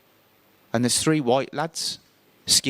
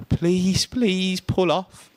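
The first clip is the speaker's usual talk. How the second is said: pleading; 8 semitones higher, much slower than usual. Retold or imitated. Skinhead with bat outside bus.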